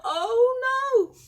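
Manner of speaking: high, loud cry of dismay. Acted. Betty sees the brew flood the floor, dismayed. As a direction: wail it as things go wrong.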